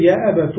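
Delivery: "يا أبت" ending in -tu; damma on the ta. Shown as a demonstration. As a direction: say "يا أبت" as ya abatu. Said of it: "أو تضمها".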